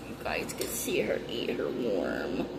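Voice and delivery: soft whisper to the viewers. Real, not acted. Owner telling viewers about the worm, whispered. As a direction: hush so the pet isn't startled. Opening enclosure near the pet.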